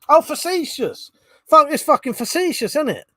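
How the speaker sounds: the penny drops, burst out facetious; sudden realisation. Real, not acted. Streamer realising the chat word was facetious, exclaiming. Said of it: "I couldn't even see that that said facetious".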